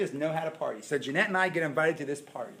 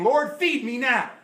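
higher, louder, slow pleading demand; whiny demand; 8 semitones higher, 8 dB louder, slower than usual. Pretend. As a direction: beg like an impatient child. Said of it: "We come to the table saying".

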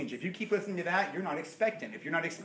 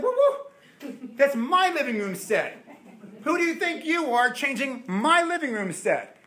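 high, loud laughing whoa; mock outrage; 9 semitones higher, 4 dB louder than usual. Homeowner to a guest changing his living room set, mock outrage. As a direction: play the offended homeowner, laughing. Owner guarding his furniture.

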